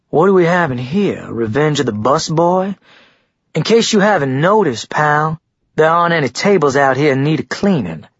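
cocky, scornful jeer; taunting. Acted. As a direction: needle him like a bully picking a fight. Frat boy taunts rival, glaring.

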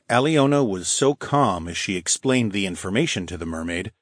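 ordinary delivery, nothing marked

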